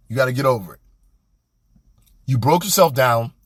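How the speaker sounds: ordinary talk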